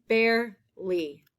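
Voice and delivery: Barely said slowly, each sound clear; slow modelling. Shown as a demonstration. Teacher slowly repeating a spelling word. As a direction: stretch out Barely for the class. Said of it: "That air sound".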